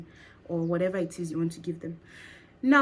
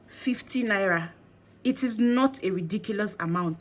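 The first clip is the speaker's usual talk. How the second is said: usual speaking voice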